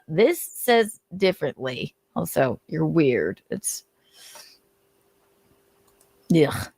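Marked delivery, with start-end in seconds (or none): none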